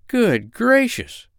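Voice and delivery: high, drawn-out astonishment; storybook doctor's high-pitched surprise; startled cry. Acted. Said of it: "cried the doctor".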